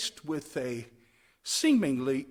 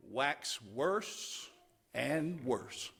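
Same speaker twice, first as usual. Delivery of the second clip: ordinary delivery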